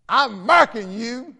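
mock British-Southern accent, high, loud and slow. Missionary marking a divisive lady, mock British-Southern accent. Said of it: "and with a British accent".

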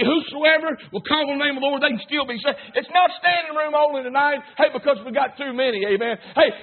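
usual speaking voice

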